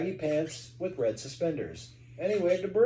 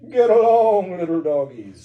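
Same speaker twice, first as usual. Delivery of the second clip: cowboy voice; 3 semitones higher, 11 dB louder than usual. Acted. Cowboy shoos the dogs.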